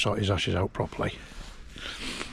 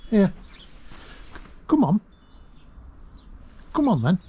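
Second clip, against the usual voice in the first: higher, coaxing call to a bird; 8 semitones higher, faster than usual. Real, not acted. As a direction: coax the blackbird in, pitched up. Calling the blackbird to feed.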